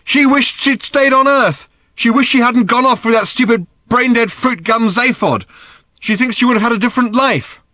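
high, loud tirade; angry outburst. A narrator's reading. Daughter tells her father of Mum's regrets, shouting angrily. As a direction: let the grievance pour out loudly.

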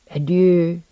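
sad farewell. A narrator's reading. Alchemist parting from his new friend, sadly. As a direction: let a touch of sorrow into the farewell. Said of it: "Lenoir said sadly".

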